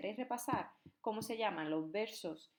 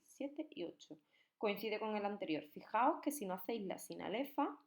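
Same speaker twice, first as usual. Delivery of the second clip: usual speaking voice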